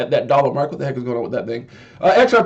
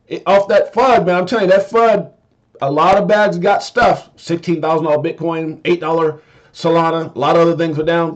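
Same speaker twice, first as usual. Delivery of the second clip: normal talking voice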